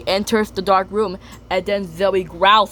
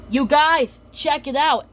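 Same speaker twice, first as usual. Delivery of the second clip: higher, eager call; Gil excited; 6 semitones higher than usual. Retold or imitated. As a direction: call friends over eagerly. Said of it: "Then Gil says".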